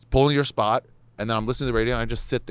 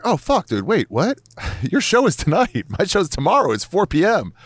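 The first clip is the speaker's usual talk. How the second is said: high, loud disbelief with laughs; laughing, stunned, telling him the news; shocked excitement; 10 semitones higher, 5 dB louder than usual. Retold or imitated. Telling a comic friend his show is tonight, loud laughing disbelief. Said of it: "I was like".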